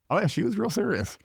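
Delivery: ordinary talk, nothing marked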